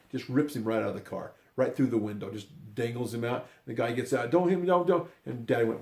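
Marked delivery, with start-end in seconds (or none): 4.3-5.1 high, loud frightened plea; panicked begging. Retold or imitated. Man pulled from car begging the dad, high panicked plea. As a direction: beg not to be hit, voice jumping up. Guy begs before being hit.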